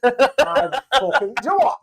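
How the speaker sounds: slow laughing words. Real, not acted. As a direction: laugh through it.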